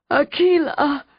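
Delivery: high excited cry in a put-on dog voice. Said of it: "Total cried".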